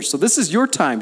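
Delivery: "your" hit high and loud, daring God; bold challenge to God. Shown as a demonstration. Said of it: "I love challenging God in this way".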